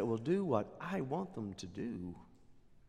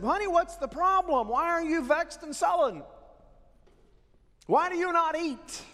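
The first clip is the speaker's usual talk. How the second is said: higher, louder syrupy queen voice; mock-caring wife, pitched way up; sweet-talking Jezebel; 12 semitones higher, 5 dB louder than usual. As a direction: coo at her sulking husband. Jezebel sweet-talking sulking Ahab.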